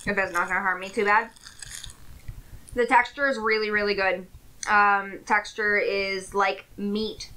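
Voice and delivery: chewing while talking; talking between bites of food; mouth partly full. Real, not acted. Seen 2: Burger in hand, jaw moving between words; refs show no food.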